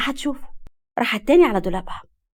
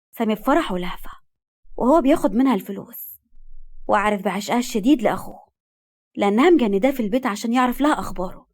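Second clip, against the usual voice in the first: plain reading voice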